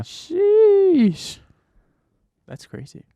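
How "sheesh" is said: surprised. Real, not acted. Reacts to three-week work stretch.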